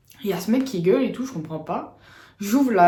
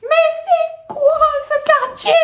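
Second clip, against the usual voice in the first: mock outraged yell; 5 dB louder, slower than usual. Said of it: "il fait".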